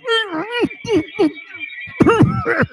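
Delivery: a high, exaggerated fake throat-clear; imitated throat clearing. Mocking a headset listener by clearing the throat. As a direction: mimic a stoned guy clearing his throat on a headset. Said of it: "Another guy starts going".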